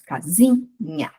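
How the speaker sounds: "casinha" slowly split, SI held before nha; penultimate syllable stressed. Shown as a demonstration. Teacher showing paroxytone words, SI of "casinha" slowly stressed. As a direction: break up "casinha" slowly, leaning on SI. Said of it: "penúltima".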